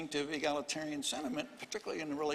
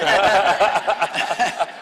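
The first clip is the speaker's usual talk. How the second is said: a big burst of real laughter, much louder; 3 semitones higher, 16 dB louder than usual. Real, not acted. Historian cracking up over his jab at Yale, loud laughter. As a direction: laugh out loud at your own joke.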